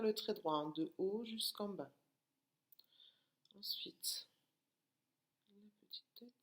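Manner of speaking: soft unvoiced whisper to herself. Real, not acted. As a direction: whisper it while drawing. Whispering while writing letter.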